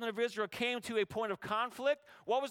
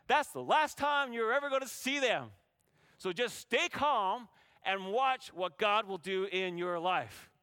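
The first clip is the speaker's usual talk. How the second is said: ordinary delivery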